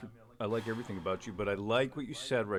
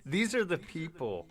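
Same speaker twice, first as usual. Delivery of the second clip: higher and louder, furious; angry rant; 7 semitones higher, 5 dB louder than usual. Real, not acted. Railing against protest permits, higher and louder in anger.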